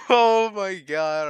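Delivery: loud, high laugh sliding into slow words; laugh, then drag it out in disbelief; laughing exclamation. Real, not acted. Streamer seeing his silly error, loud laughing exclamation. Cracks up at the mistake.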